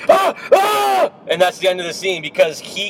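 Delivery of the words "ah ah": high, short imitated scream; a quick hammed-up shriek; mock scream. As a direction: scream it like the guy in the film. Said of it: "he goes".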